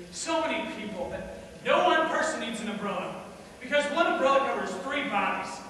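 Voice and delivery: loud indignation at the crowd; exasperated rant. Acted. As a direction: build to an incredulous, high, loud question. Exasperated rant about the crowded rainy street.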